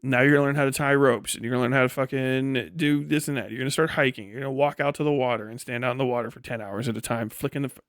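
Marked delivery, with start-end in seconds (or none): none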